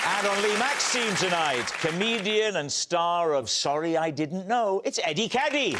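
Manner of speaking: upbeat TV panel-show introduction; show host announcer. Acted. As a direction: lift each guest's name for applause.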